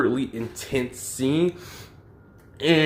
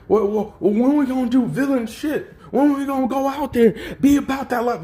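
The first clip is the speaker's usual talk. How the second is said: high, far-off goofy voice of an eager villain recruit; dumb eager henchman; 13 semitones higher, 4 dB louder than usual. Retold or imitated. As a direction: play him as a dopey wannabe itching for action. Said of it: "he just sounds stupid".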